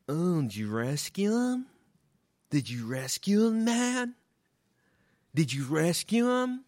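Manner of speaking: airy mocking hippie voice; comic mocking a hippie dog owner. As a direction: ham up the smug hippie asking.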